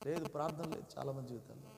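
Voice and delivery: ordinary talk, nothing marked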